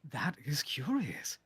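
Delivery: unvoiced curious whisper. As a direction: breathe it out softly. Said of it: "I'm like".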